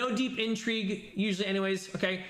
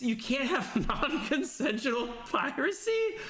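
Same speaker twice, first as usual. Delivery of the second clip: laughing disbelief; 3 semitones higher, slower than usual. Real, not acted. Repeating the absurd rule.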